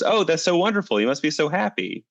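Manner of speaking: high, gushing congratulations; overly sweet mock delight; gushing villagers. Villagers voiced in a proverb story.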